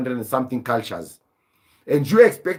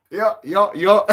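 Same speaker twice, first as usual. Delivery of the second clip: laughing; 3 semitones higher, slower than usual. Real, not acted. Laughing at Kimbo Slice comparison.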